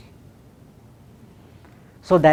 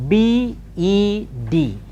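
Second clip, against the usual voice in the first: slow letter-by-letter dictation, higher; spelled out; 5 semitones higher, slower than usual. Shown as a demonstration. Teacher dictating words to transcribe, slowly spelled out. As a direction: spell it slowly, each letter held and separate. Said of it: "I shall spell each word".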